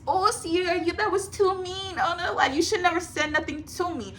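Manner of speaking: high, mocking whine of their complaints; whiny mock voice.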